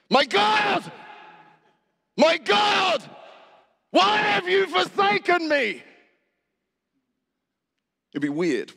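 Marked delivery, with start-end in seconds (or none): 0.1-5.8 crying out much higher and louder in anguish. Shown as a demonstration. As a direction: wail it out to God, slow and full-throated. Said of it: "If I said".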